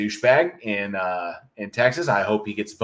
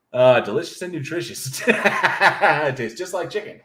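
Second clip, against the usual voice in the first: words broken by real laughter, slower; laughing; 5 semitones higher, 3 dB louder than usual. Real, not acted. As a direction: chuckle your way through the joke. Joking about eating hot dogs.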